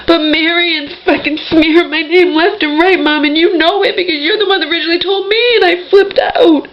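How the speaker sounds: sobbing out the grievance, pitch rising; real crying. Real, not acted. Daughter crying to her absent mom, high and tearful. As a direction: voice climbing high as she breaks into tears. Upset recounting mom's betrayal.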